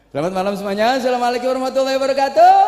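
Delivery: high, rushed stage salam rising to the end; singer's greeting. Acted. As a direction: race upward like a singer hailing fans. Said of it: "saya salam pakai gayanya penyanyi".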